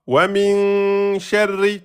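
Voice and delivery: slow chanted "Min sharri" with a long held min; Quran recitation. Shown as a demonstration. Teacher chanting verse slowly for students. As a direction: intone it like tajwid recitation. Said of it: "Là, vous avez quoi".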